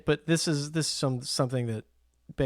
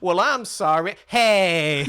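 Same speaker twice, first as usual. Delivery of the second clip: imitating the guy; 4 semitones higher, 5 dB louder, much slower than usual. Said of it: "And he goes".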